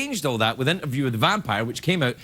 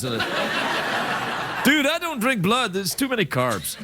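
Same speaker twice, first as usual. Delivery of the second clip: put-on slow, higher brooding teen heartthrob; Twilight vampire; 5 semitones higher, much slower than usual. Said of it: "today's vampires are Twilight".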